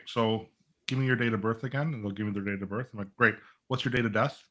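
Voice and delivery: usual speaking voice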